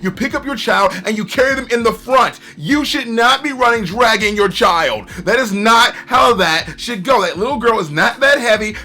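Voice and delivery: loud, scolding lecture at a careless parent; exasperated rant. Real, not acted. Reviewer scolding the girl's parent, loud and exasperated. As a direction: really let that parent have it. Ranting at negligent anime parent.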